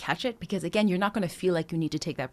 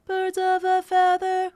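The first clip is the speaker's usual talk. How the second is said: high loud, flat even notes, robotic; 12 semitones higher, 6 dB louder, slower than usual. Shown as a demonstration. Coach showing the wrong robotic way. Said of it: "what we don't want is to sound like this".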